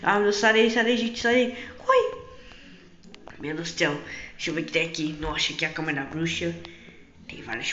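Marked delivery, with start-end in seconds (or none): 0.0-2.2 high, loud yelling; chasing intruders out, yelling; shouting chase. Acted. Witch chasing them.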